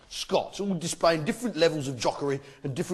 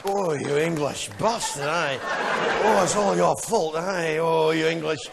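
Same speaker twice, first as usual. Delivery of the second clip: slow, moaning Scottish grumble; Scotsman being pleasured; 2 semitones lower than usual. Acted. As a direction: moan the insult as if being rubbed with warm leather. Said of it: "sounds like he's having his genitals rubbed".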